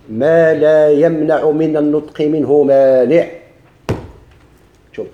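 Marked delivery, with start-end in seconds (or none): 0.1-3.3 slow, louder recitation of the definition; recited rule. Shown as a demonstration. Teacher reciting the grammar rule to students, slow and loud. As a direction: chant the rule slowly like a memorized text. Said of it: "شكون الإعراب اللفظي".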